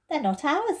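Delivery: doubtful. Acted. Peter doubts they should take the coats, hesitant. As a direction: sound unsure about it. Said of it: "said Peter doubtfully".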